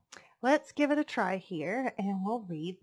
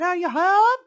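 high, very loud calling out; yelling; 10 semitones higher, 12 dB louder than usual. A narrator's reading. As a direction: shout the question through a door. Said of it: "someone yelled outside".

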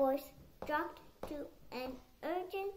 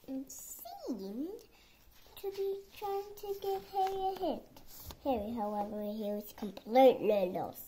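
ordinary delivery, nothing marked

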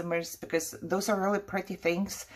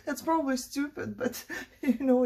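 giggling through the words; laughing self-consciously; 8 semitones higher than usual. Real, not acted. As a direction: laugh it off, a bit embarrassed. Laughs at her own bird worry.

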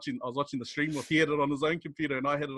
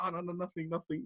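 softer, high innocent denial; playing it off; 4 semitones higher, 9 dB quieter than usual. Hiding excitement from the office, soft innocent denial. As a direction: brush it off quietly. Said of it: "we're just playing it off".

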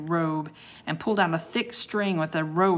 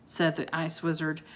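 ordinary delivery

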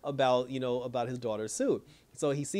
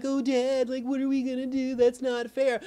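high, whiny mock of bratty sons; mocking whiny kid voice; mocking whine; 9 semitones higher, 4 dB louder than usual. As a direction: mimic the kids complaining to dad. Mocking the sons whining to dad.

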